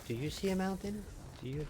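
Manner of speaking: high, mocking kids'-show question; retold screaming at TV. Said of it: "start screaming at the T V".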